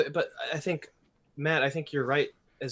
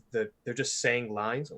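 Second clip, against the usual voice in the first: usual speaking voice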